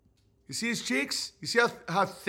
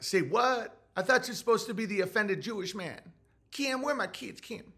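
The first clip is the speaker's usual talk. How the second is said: higher, softer put-on voice; 3 semitones higher, 5 dB quieter than usual. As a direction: play him thrown and fretful, trailing to quiet. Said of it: "And he'd be like".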